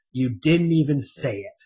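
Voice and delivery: ordinary delivery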